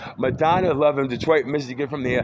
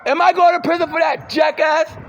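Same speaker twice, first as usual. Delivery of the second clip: angry shout; 6 dB louder than usual. Real, not acted. Yelled at accusers.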